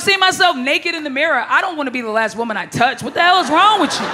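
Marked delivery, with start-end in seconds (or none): 3.0-4.1 mock outrage. Ranting at soft boyfriend.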